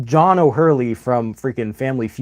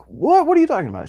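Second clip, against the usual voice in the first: high, mock-innocent "What?"; feigned innocent surprise; 14 semitones higher, faster than usual. Line cutter retold to viewers, mock-surprised and much higher. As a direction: imitate a caught cheat playing dumb.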